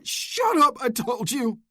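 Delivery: high, loud furious roar. Acted. Oscar roars back at taunting Luke, furious. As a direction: roar it out in rage. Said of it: "Oscar roared again".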